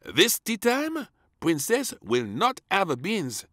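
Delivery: high, slow, firm announcement; declaring chef. Acted. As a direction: lay down the law. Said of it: "declared the chef".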